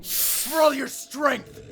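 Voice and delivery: high loud defiance; defiant shout. Acted. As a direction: hurl it at the king. Defying the enemy king.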